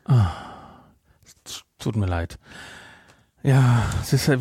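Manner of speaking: ordinary talk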